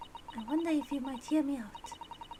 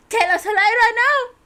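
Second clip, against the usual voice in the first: loud demand; 7 semitones higher, 13 dB louder than usual. Acted. Reading demand aloud.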